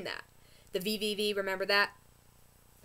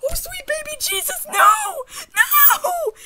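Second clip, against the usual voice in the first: screaming, far higher and louder; horrified scream; 12 dB louder, slower than usual. Retold or imitated. Reliving the twist on the show, horrified screaming wail. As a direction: wail no in horror.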